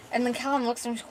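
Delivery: plain reading voice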